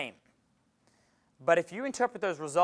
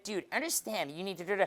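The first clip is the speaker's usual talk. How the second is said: inner voice; 8 dB quieter than usual. Retold or imitated. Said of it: "Voice in your head".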